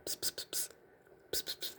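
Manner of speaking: hushed "psst psst" of overheard whispers; very soft voiceless whisper noises; imitated whispering. Sergeants conferring out of earshot, imitated as faint whispering. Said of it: "They're whispering back and forth".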